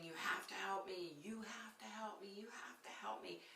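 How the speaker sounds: high, hushed mock scream; softly strained, high-pitched plea for help; retold scream. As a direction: imitate a desperate scream under your breath.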